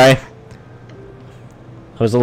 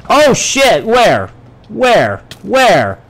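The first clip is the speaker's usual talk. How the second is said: panicked searching; 6 semitones higher, 3 dB louder, slower than usual. Real, not acted. Creeper about to explode.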